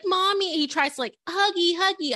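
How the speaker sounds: put-on high, sweet wheedling child; cutesy toddler voice. Mother imitating her son begging for hugs. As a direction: coo like a little boy wanting hugs. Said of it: "He's like".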